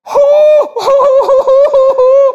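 high loud burst of laughter; big shouted laugh. Real, not acted. Preacher cracking up at his own cat joke, loud laughing burst.

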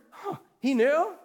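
usual speaking voice

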